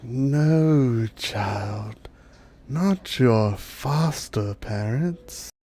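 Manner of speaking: slow, softened dryad correction; gentle dryad. Acted. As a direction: draw it out gently, as to a child. Dryad reveals the child's parentage.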